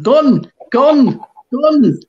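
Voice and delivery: loud, high mock shout. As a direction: shout each word as its own alarm.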